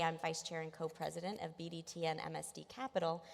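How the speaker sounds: ordinary talk, nothing marked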